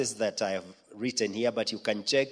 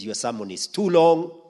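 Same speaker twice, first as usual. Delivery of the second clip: raised, louder rebuking voice; a higher, louder divine correction; voicing God's rebuke; 4 semitones higher, 7 dB louder than usual. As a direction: scold the preacher from on high. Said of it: "he's told".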